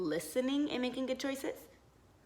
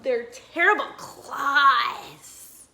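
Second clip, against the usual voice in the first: spooky; 9 dB louder, slower than usual. A narrator's reading. Spooky monster description.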